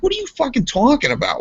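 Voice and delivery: high-pitched incredulous retort; retold exasperated yelling. Arguing with Adam over his excuses, retold exasperation. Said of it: "I'm yelling at him".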